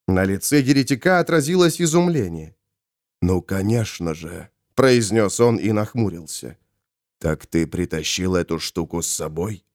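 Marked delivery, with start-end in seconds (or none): 3.2-4.5 low, soft realisation; hushed low voice; musing Molokh. Acted. 7.2-9.6 soft, low-pitched question; hushed, low inquiry. Acted. Ravenor and Molokh confer.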